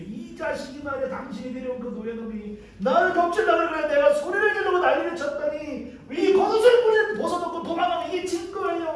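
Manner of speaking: hysterical accusation. Acted. Wife falsely accusing Joseph to her husband, hammed-up hysterical outburst. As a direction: rant shrilly like a wronged wife. 아내가 남편에게 거짓 고발.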